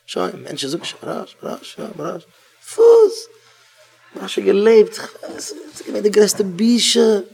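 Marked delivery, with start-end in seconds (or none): 1.0-2.2 hushed, breathy call of the name; whispered calling. Acted. Searching for Marasch, a whispered call. 2.6-3.3 sudden shrill squeak, high and loud; high yelp. Acted. Startled, a high yelp.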